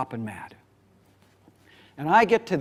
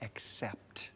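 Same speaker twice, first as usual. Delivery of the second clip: whispered word; 9 semitones lower, 17 dB quieter than usual. Shown as a demonstration. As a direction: breathe the word "accept." as a soft whisper. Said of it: "that first word".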